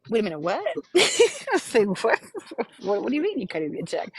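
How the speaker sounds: laughing, rushed disbelief, quick and amazed; stunned disbelief. Retold or imitated. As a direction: blurt it out, not believing your luck. Stunned by free money news.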